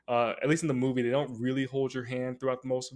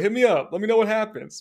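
ordinary delivery

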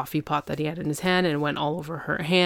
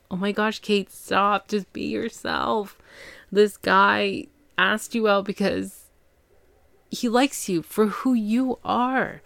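mock pleading; 5 semitones higher, slower than usual. Pleads with character to stop pretending.

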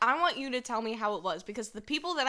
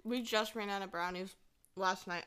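usual speaking voice

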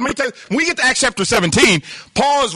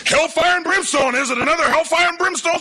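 higher-pitched grumbling imitation; mock complaint; 7 semitones higher than usual. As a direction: parody a churchgoer tired of the same sermon. Acts out weary churchgoers' complaint.

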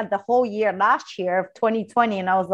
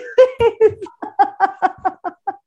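loud high laugh; 9 semitones higher, 4 dB louder than usual. Real, not acted. Laughing at her own crying story, loud and high.

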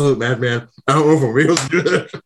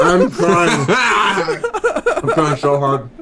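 laughing; 4 semitones higher, much slower than usual. Real, not acted. Struggling to eat, laughing.

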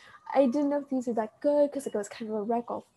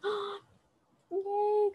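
re-enacted delight; 9 semitones higher than usual. Re-enacts emotional happy reaction.

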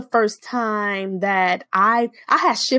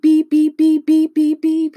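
high, louder beep beep like a phone alert; 7 semitones higher, 5 dB louder than usual. Retold or imitated. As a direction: chirp it out like a text notification. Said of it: "my phone went beep".

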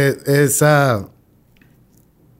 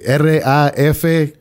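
slow, letter by letter; spelled out slowly; slower than usual. Shown as a demonstration. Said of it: "spells out his handle".

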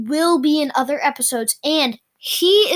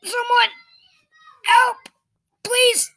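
crying for help; 12 semitones higher, 4 dB louder than usual. Acted. Calling for help.

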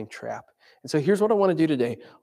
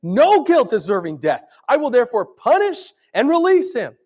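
high, loud exasperated plea; pleading Pilate; 10 semitones higher, 6 dB louder than usual. Retold or imitated. Pilate pleading with the shouting crowd, loud and high. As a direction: argue desperately with the crowd.

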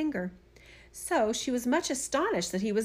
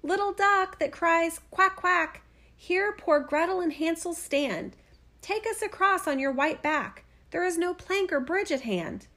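calling out; 7 semitones higher than usual. A narrator's reading. Gretel asking the duck across the water, high calling rhyme. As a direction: call the rhyme out high and measured. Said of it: "So she cried".

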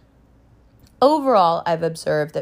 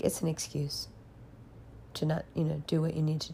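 hushed, much softer retold line; imitating mom's whisper, much softer; 14 dB quieter than usual. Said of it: "she whispered it".

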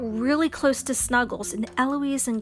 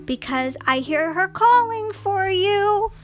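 high, sweet coaxing voice; gentle unicorn voice; 9 semitones higher, 5 dB louder than usual. Acted. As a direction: soothe the little dragon away. Snuggles coaxes the dragon.

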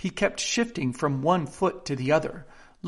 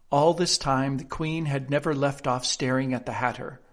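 ordinary delivery, nothing marked

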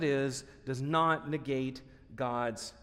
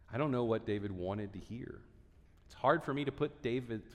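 usual speaking voice

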